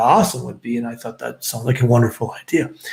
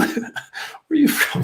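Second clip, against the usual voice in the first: very high-pitched, eager quote; imitated excited question. Canadian stranger pressing recruit for his hometown, excited. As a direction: mimic a delighted stranger digging further.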